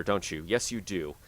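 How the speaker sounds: talking through hiccups; hiccupping between words. Real, not acted. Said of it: "I have the hiccups every time".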